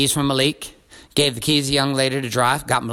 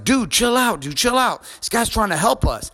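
higher-pitched urgent buddy voice; pleading friend; 9 semitones higher than usual. Retold or imitated. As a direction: imitate a friend calming someone down. Friend intervenes in retold quarrel.

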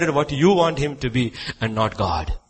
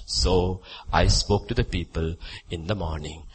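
ordinary talk

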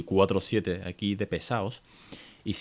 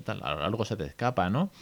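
ordinary talk, nothing marked

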